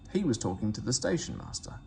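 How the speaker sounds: ordinary delivery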